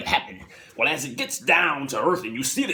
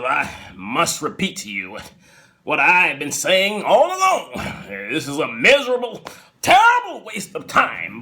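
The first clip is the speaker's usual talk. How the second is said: louder, preachy scolding; stern lecture; 3 semitones higher, 4 dB louder than usual. Retold or imitated. Husband scolds wife about gambling, pompous. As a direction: lecture her like a disapproving husband.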